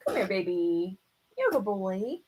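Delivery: quiet, tender cooing to a bird; soft pet talk. Real, not acted. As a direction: coo gently to your hawk. Talking softly to his bird.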